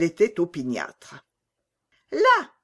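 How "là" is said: put-on high, loud, demanding child voice; a bossy little girl insisting on her toy; spoiled robber girl. A narrator's reading. Robber girl claims Gerda from her mother, high demanding child voice. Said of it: "dit-elle".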